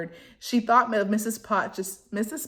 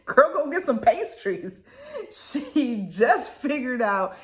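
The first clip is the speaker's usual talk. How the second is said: louder, higher, laughing approval; laughing cheer; 4 semitones higher, 4 dB louder, slower than usual. Real, not acted. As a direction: cheer her on while laughing.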